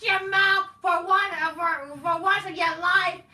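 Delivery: high, loud sing-song mockery of a wrestler's promo; shrill-free mocking sing-song of Rollins yelling at a rival. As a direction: whine it out high and loud to ridicule him. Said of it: "the fucking fact that Rollins like".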